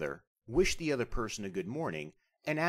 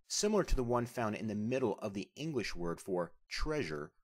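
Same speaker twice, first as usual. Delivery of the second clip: ordinary delivery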